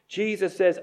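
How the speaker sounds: ordinary delivery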